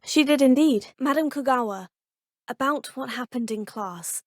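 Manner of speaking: usual speaking voice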